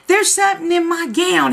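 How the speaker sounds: scared wife waking her husband; panicked. Retold or imitated. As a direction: blurt it out in a panic.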